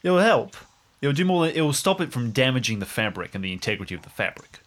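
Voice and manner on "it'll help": ordinary delivery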